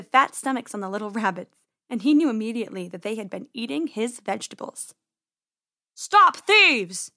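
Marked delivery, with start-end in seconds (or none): 6.0-7.1 high, loud shout at thieves; outraged farmer shouting; angry farmer's yell. A narrator's reading. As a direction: yell it across the garden. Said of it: "he yelled".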